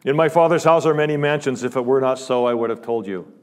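fervent preacher voicing Jesus; preaching force. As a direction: ring it out over the congregation. Preaching Jesus' promise at full force.

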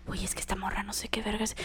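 quick, hushed whisper; very quiet whisper. Retold or imitated. Friend hiding inside phones for help, very quiet whisper. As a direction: whisper it fast so nobody hears. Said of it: "estaba hablando súper quedito así de".

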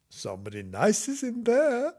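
high, sing-song mother; mummy's voice. A narrator's reading. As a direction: tease the children with a secret, high. Mummy's line in children's story.

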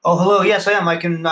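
normal talking voice

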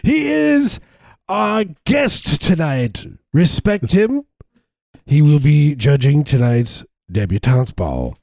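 high, drawn-out introduction; ball announcer. Acted. Announcing tonight's guest judge to the ball, grand announcer voice. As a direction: announce the guest with slow, stretched words.

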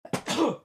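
a big real sneeze; sneezing. Real, not acted. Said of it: "I have to sneeze".

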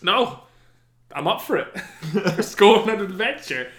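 high, loud, cheery eagerness; up for adventure. Acted. Playing Gendry keen for adventure, high and eager. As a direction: answer gamely with a laugh breaking in. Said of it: "people just up for adventure".

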